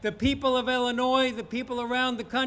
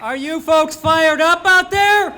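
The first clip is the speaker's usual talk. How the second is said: loud, higher hype shout; rally emcee shouting to the crowd; firing up crowd; 5 semitones higher, 10 dB louder than usual. Real, not acted. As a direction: pump up the crowd.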